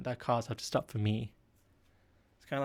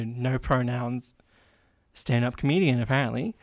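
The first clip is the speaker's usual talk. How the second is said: normal talking voice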